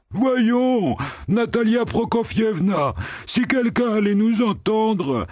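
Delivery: the pig begging Natasha to hush; pleading pig-man. A narrator's reading. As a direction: beg her in a panic. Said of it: "criait, suppliant le porc gras".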